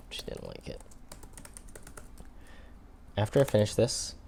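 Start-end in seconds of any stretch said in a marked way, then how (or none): 0.1-0.8 whispered aside; whispering. Real, not acted. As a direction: drop to a whisper. Aside after the buddy voice.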